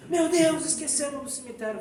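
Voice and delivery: loud, high panicked shout; alarmed cry. Retold or imitated. Friends realising they forgot Jerônimo, panicked shout.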